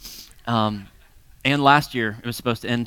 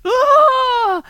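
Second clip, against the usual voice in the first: high, loud open-throated "ah" scream; sustained high-pitched mock scream; crowd scream; 8 dB louder than usual. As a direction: imitate an alarmed crowd screaming. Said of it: "everybody, when he jumped in, went".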